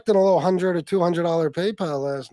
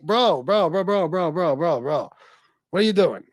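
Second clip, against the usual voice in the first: rapid-fire repeated bros, pitched up; mock pleading interruption; 3 semitones higher than usual. Pleading with a friend naming higher-ups, mock alarm. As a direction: cut in urgently, as if to stop a rambler. Acted plea to stop talking.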